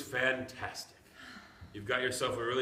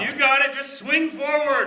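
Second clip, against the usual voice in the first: urgent call; 14 semitones higher, 12 dB louder than usual. Acted. Grandpa urging falling boy.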